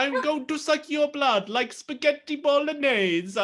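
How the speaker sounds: very high, loud hammed-up Italian accent; Italian vampire chef, Italian accent. Continuing the Italian vampire bit.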